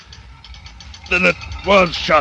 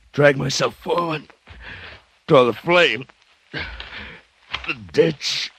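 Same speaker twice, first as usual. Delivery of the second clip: exhausted, fading gasps; 3 semitones higher, 4 dB quieter, slower than usual. Acted. Dying man struggles through ants.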